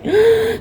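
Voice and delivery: sharp storyteller's gasp of wonder; sudden high intake of breath, amazed. Acted. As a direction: gasp in acted amazement for the children. Storyteller amazed at long tree.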